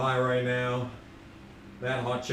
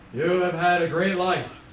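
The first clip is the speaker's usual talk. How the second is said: high, loud, hammed-up proclamation; put-on celebrity accent, greeting the newly dead; odd Keanu Reeves accent; 6 semitones higher, 7 dB louder than usual. Streamer playing Keanu as God, odd grand accent. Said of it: "I don't know why that's his accent".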